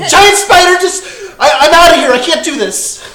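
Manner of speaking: high, loud cry while fleeing; terrified runaway, played big; hammed-up panic. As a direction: blurt it out in a mock panic. Plays woman fleeing fake spider in panic.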